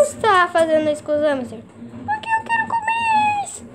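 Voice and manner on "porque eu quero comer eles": very high squeaky creature voice; squeaky Gutinho voice. Pretend. Gutinho confessing he wants to eat the hamsters, squeaky. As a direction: squeak it like a tiny greedy monster. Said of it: "a voz do Gutinho".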